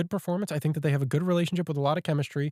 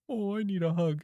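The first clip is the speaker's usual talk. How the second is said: little monster voice; 4 semitones higher, 4 dB quieter than usual. Retold or imitated. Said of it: "is all he said".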